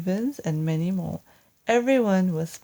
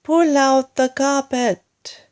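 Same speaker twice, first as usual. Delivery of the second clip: slow, loud command to pull; shouted order; 6 semitones higher, 5 dB louder, slower than usual. A narrator's reading. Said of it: "shouted Mugglewump".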